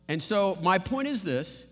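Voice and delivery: ordinary talk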